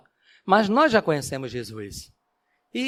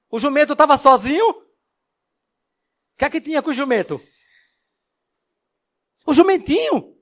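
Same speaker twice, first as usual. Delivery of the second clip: quizzing audience; 9 semitones higher, 6 dB louder, faster than usual. Acted.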